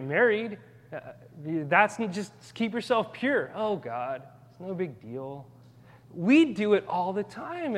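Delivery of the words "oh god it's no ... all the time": mumbled, much softer eye-rolling brush-off; whiny dismissive mock. Pastor mimics churchgoers waving off God's commands, softer and whiny. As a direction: sigh it off like a bored teen. Mocking people who dismiss God.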